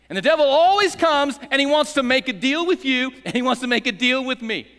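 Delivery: loud, high preaching; preaching at full force. Real, not acted. Pastor warning the congregation about the devil, full-voiced preaching. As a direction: drive it home from the pulpit.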